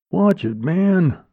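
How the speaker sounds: high, drawn-out protest; annoyed warning. A narrator's reading. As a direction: complain after being bumped, stretched slow. Bumped man protests.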